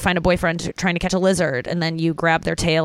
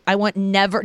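ordinary talk

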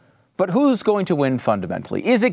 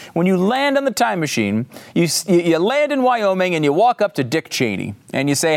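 ordinary talk

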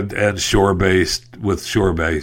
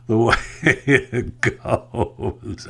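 laughing; 5 dB quieter, much slower than usual. Real, not acted. Laughs at sinking ship.

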